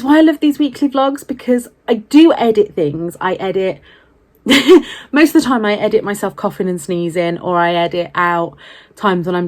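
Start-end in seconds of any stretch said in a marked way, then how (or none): 4.4-5.1 loud burst of laughter. Real, not acted. Bursts out laughing mid-sentence.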